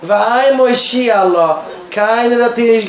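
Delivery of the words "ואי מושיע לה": drawn-out, mild cry for help; retold outcry. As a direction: cry it out slowly, only half raised. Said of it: "און זי האט געשריגן". (געצויגן, האלב אויסגעשריגן)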